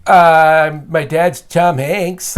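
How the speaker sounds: loud, drawn-out stalling reply; stall, then offer it slowly as your act; stumped performer. Acted.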